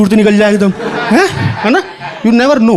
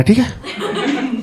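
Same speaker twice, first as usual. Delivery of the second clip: whispered okay; 3 semitones higher, 8 dB quieter, much slower than usual. Retold or imitated.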